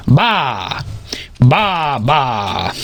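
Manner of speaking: drawn-out mock baas; sheep bleating.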